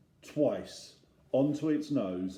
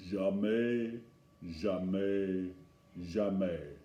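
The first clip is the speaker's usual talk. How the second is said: stern French farmer; 4 semitones lower, much slower than usual. Acted. French farmer wagging finger, scolding.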